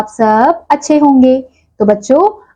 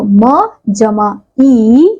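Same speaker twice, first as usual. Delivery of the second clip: put-on slow, careful spelling out for children; much slower than usual. Shown as a demonstration. Teacher building a syllable for the class, slowly. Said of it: "ऐसे ही अगला है म".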